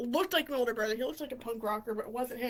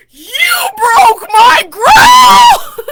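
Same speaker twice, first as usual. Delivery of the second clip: huge drawn-out high bellow; hammed-up slow-motion roar of outrage; enraged scream; 11 semitones higher, 24 dB louder, much slower than usual. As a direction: imitate a furious stepfather, screaming every word long. Enraged stepfather in story.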